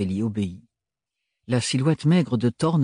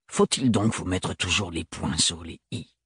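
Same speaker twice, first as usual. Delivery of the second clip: agacement contenu; 5 semitones lower, 4 dB quieter than usual. A narrator's reading. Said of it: "tellement excédé".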